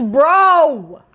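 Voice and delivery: loud exasperated bro. Real, not acted. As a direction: blurt it out in mock outrage. Joking complaint about no sex.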